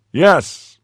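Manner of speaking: loud, high call in answer. A narrator's reading. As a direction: call back so he hears through the door. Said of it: "raising his voice in turn".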